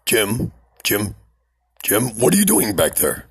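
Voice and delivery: usual speaking voice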